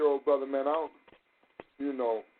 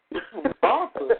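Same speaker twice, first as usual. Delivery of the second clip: high, louder, slow incredulous question; mock incredulous; 8 semitones higher, 4 dB louder than usual. Asking a Hartford guy where his country accent comes from, slow and incredulous. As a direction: stretch it out in disbelief.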